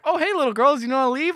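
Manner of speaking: high, chirpy greeting; mock cheerful hero. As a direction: play him cluelessly friendly. Hero chatting to ghost girls.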